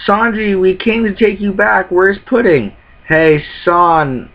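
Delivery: lightly voiced crew calling up the tree; calling to Sanji.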